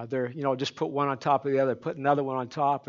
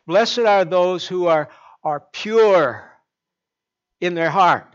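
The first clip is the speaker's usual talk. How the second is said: slow, loud, drawn-out verse; preacher intoning scripture; solemn scripture reading; 3 semitones higher, 10 dB louder, slower than usual. Acted. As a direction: proclaim the verse with weight. Scripture read with emphasis.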